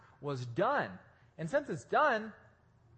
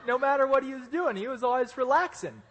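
high and loud through laughter; laughing; 7 semitones higher, 6 dB louder than usual. Real, not acted. Telling the church about Pablo, high, loud and laughing. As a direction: laugh as you land the punchline.

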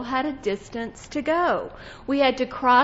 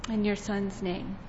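praying; 4 semitones lower than usual. Acted. Closing prayer.

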